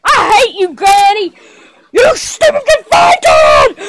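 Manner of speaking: ordinary talk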